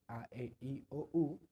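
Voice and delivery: each vowel A, E, I, O, U said clearly and separately; name A, E, I, O, U one by one, rising on O, U; vowels spelled out. Shown as a demonstration. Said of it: "que son las convencionales". (vocales A, E, I, O, U pronunciadas una por una)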